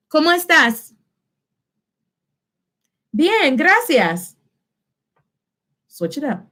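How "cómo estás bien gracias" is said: high, lilting practice exchange; lifted, deliberate greeting voice; role-play greeting. Shown as a demonstration. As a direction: pitch it up and leave the phrase ringing. Acted greeting practice with listener.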